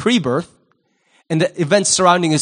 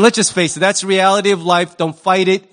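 normal talking voice

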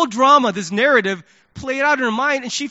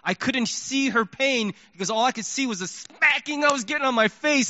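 usual speaking voice

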